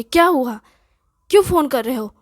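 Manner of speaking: loud, furious snap at the caller; angry shout. A narrator's reading. Amrita, hungry and fed up, yells at boyfriend on phone, angry shout. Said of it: "चीखों भरी ज़ोरदार गुस्से वाली आवाज़".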